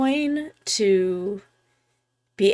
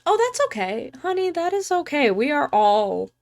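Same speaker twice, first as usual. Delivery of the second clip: reassuring; 6 semitones higher than usual. Real, not acted. Reassuring a viewer.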